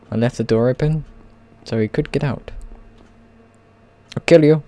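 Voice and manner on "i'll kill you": loud playful threat; raised-voice mock menace. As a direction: threaten loudly in jest. Mock threat to escaping villager.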